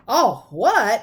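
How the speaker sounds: loud, high, thundering question; thundered. A narrator's reading. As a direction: bellow it at them. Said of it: "Hagrid thundered".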